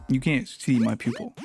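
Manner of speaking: ordinary delivery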